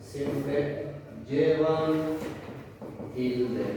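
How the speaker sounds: "Siempre", "llevan", "tilde" each said alone with pauses; word-by-word emphasis. Shown as a demonstration. Teacher summing up the rule for students. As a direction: drill the rule one word at a time. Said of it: "No hay regla".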